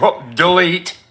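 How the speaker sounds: lightly voiced dismissive woman; mocking quote. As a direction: toss it off like she's caught out. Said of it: "she's like".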